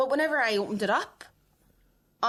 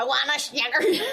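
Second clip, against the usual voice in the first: laughing; 9 semitones higher, 5 dB louder than usual. Acted. Laughing mock craving.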